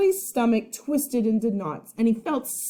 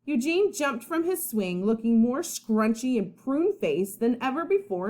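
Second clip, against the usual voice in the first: usual speaking voice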